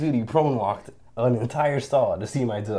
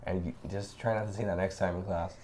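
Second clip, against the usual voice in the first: teacher scolding gently; 3 semitones lower, 8 dB quieter than usual. Retold or imitated. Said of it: "she brings me like".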